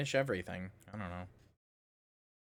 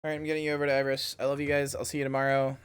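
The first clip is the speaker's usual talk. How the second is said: usual speaking voice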